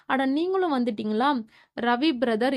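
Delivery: ordinary delivery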